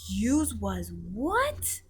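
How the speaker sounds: slow, stretched shocked question; astonished cry. A narrator's reading. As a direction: drag out the disbelief. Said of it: "cried the B F G".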